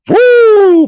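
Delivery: high, loud woo of hype; excited whoop. Real, not acted. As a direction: whoop like the bell just rang. Hyped about the fight.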